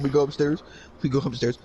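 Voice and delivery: usual speaking voice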